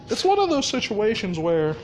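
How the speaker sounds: ordinary talk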